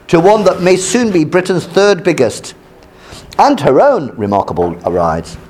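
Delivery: measured read-aloud of a news article. Acted.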